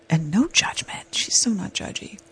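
hushed unvoiced whisper; whispered aside. Real, not acted. Praising her friend to listeners, in a whisper. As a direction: let it slip out as a secret. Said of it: "whispered emphasis aside".

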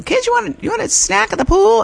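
put-on high, singsong call to the kids; cheerful dad calling. Dad calling the kids to a poolside snack, high and singsong. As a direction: sing it out like a dad offering snacks. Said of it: "And it was like".

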